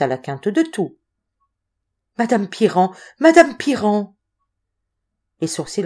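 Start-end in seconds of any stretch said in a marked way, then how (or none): 2.2-4.1 feverish calling of her name, rising in pitch and volume; quivering vehemence. A narrator's reading. Delirious boy grabbing her hand, trembling vehemence. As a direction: call out to her with building fervour. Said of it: "avec une véhémence frémissante".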